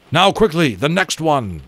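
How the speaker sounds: loud, high urgent command; urgent call. A narrator's reading. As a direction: call out the order. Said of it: "Lawrence called to Temeraire".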